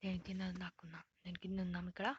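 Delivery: hushed whisper; whispering while hiding. Real, not acted. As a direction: whisper so he can't hear.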